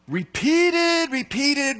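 high, loud repeated word; ringing emphasis on Repeated; emphatic repetition. Shown as a demonstration. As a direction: hammer the word home. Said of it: "repeated things are emphasized things".

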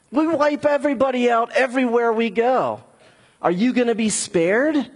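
the Assyrian king mocking the city; taunting bully. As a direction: sneer at them like a bully king. Preacher voices the taunting Assyrian.

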